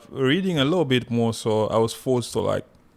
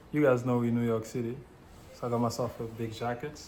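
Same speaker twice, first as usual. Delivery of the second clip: quiet character read; 9 dB quieter, faster than usual. Acted. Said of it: "I'll play it so you guys could see".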